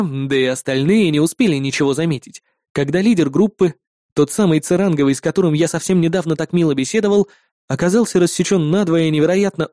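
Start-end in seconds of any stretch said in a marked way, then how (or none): none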